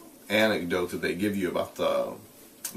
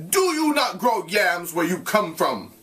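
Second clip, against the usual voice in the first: put-on father shouting at the boy; threatening shout; 8 semitones higher, slower than usual. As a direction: bark the question at the boy. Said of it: "he said so with much threatening".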